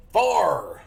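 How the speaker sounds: "Far" loud and high with a long hard R; extra R. Shown as a demonstration. Showing off the extra R in fire, "Far" loud with heavy R. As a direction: belt out "Far" for fire, growing the R. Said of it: "I did them extra R's".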